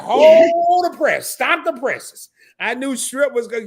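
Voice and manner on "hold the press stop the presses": high, loud, slow mock alarm; mock news shout. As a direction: stop the presses like big news. Mock breaking news exclamation.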